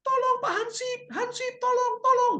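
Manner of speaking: very high falsetto mock plea; calling for help. Girl calling the village watchman, high falsetto plea. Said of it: "ada Hansip yang jaga di kampung".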